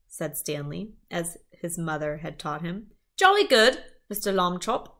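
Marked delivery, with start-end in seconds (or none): 3.2-4.9 high cheery British jolly good; chirpy British caller; British accent. Acted. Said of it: "It also had an accent".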